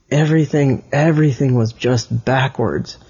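plain reading voice

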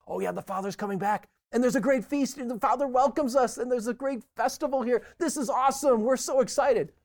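high, loud, gushing with excitement; mock-excited listeners celebrating; excited crowd. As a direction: imitate a thrilled crowd cheering the news. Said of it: "They're like".

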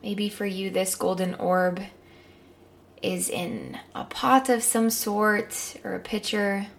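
calm guided meditation. Acted.

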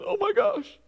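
high, panicked yelp; frightened gasp. Retold or imitated. Preacher acting a disciple's cowardly panic in the storm. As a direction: squeak it out in sudden fright. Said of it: "Cowardice is".

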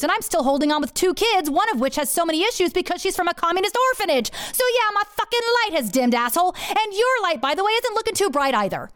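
high, rapid indignant tirade; snapped back. Retold or imitated. Wife listing her marriage's hell to her lover, snapping back. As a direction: rant it out in a high, heated rush. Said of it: "I snapped back".

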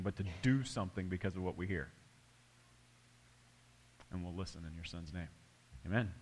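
soft, low opening prayer; hushed pastor's prayer. Acted. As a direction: pray quietly over the congregation.

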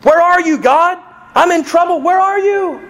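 loud, high desperate plea to heaven; psalmist screaming. Retold or imitated. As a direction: scream to God like a man in trouble. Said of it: "he's screaming half the time".

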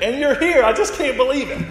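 high, loud, thrilled disbelief; delighted amazement. Retold or imitated. Student to the minister who answered her prayer, delighted amazement. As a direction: act out joyful astonishment, pitched well up. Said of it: "so she smiled and said".

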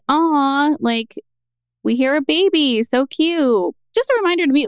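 put-on high, cooing "aw, cute baby" voice; acting out a sweet, gentle reminder; nicer, kinder tone. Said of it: "said it nicer".

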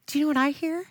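ordinary delivery

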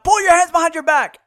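loud, high barked order; police shout. Retold or imitated. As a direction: shout orders like an arresting officer. Acting armed police shouting orders.

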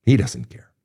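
whispered, for emphasis; hushed whisper driving the point home. Shown as a demonstration. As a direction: whisper it so it lands. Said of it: "Did you hear me on that one?".

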